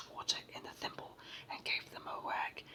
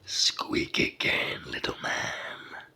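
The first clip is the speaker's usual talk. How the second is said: big, slow giant voice, amused; loud and drawn out, like a huge creature; thundering giant; 9 dB louder, much slower than usual. A narrator's reading. Giant amused by his tiny captive, booming slowly. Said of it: "in a voice like very near thunder".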